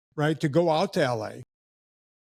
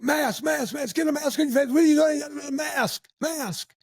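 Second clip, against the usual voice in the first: retold yelling; 10 semitones higher than usual. Starbucks staff yelling at a customer to mask up, high mock yell. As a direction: bark the mask demands like a panicked crowd. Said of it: "people started yelling".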